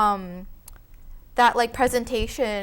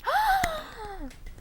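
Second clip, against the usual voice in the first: gasp; 7 dB quieter than usual. Real, not acted. Hearing of a birthday.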